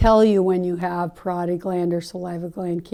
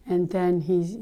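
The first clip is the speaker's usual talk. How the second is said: normal talking voice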